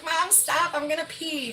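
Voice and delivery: put-on high, giggly plea of a tickled kid; high daughter voice. Mom imitating her daughter during a tickle fight, high pleading voice. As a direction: squeal it like a little girl begging. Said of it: "and she'd be like".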